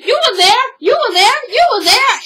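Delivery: high, loud, punched repetition; insistent accusation. Retold or imitated. Kay accuses her friend in a retold quarrel, loud and insistent. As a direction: hammer the charge home in a mock argument.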